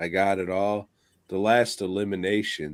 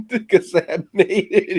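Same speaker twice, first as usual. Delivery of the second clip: high, giggly words; laughing; 10 semitones higher, 5 dB louder than usual. Real, not acted. Laughing while explaining his pick. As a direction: laugh through it.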